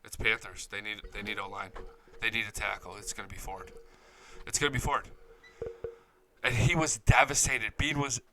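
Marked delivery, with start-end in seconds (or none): 0.0-5.1 much softer, lower, resigned murmur; quiet retold dread. Beane telling the owner the pick is lost, hushed. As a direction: say it under your breath like bad news sinking in. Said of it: "looks at Terry Pegula and goes".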